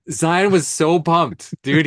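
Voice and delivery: loud, high, drawn-out enthusiasm; real excitement. Real, not acted. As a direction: stretch it out, thrilled for him. Excitedly recalling the assistant's reaction.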